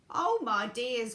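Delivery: loud, slow acted wail; crying out. A narrator's reading. Grandma Georgina cries out in dread, loud and slow. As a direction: exclaim it in drawn-out dismay. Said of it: "cried Grandma Georgina".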